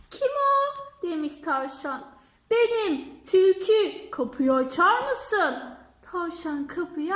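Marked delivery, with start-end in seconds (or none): 0.1-1.0 rabbit asks. Acted. Rabbit answers the door. 2.5-5.5 fox at the door. Acted.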